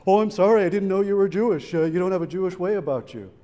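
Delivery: mocking him in a loud, high sneer; sarcastic mock apology. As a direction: throw his words back with biting sarcasm. Mocking the man's antisemitic remark.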